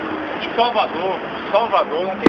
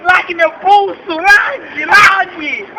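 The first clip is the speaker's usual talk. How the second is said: hammed-up pain cries; 4 semitones higher, slower than usual. Friend grabs wrist, mock victim.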